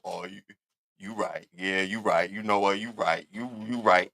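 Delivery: low, soft, mumbled giving in; cowed man mimicked. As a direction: shrink back and concede quietly.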